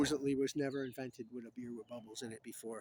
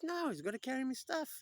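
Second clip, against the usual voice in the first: high put-on protest; objecting knight; 13 semitones higher, 3 dB louder than usual. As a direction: refuse in a pitched-up character voice.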